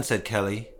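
plain reading voice

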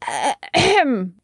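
hen clearing throat. A narrator's reading. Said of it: "plumping out her feathers".

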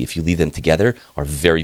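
usual speaking voice

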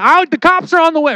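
high, quick mock yell. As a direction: yell it high and fast, imitating panic. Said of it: "you're like yelling that".

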